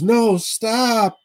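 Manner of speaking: hammed-up wife begging him to stop; mock wife plea. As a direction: squeal it like the pleading wife. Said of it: "she says".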